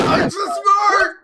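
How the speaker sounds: very high, loud triumphant squeal; exultant shout. Real, not acted. Player crowing over a solved riddle, exultant squeal. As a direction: shriek it with delight, stretching it out.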